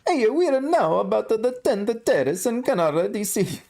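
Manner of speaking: high falsetto mock-Italian accent, Mario style. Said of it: "I gotta do the Mario accent now".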